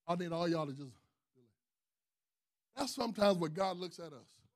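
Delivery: ordinary delivery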